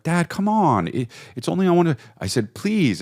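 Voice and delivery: put-on pleading child. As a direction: beg like a little boy who wants to stay up. Child begging dad to stay up.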